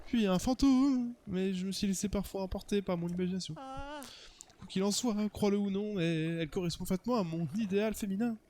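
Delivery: theatrical romantic hero telling his tale; dreamy character voice, measured delivery; lovestruck suitor. Acted. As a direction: play an earnest, smitten admirer.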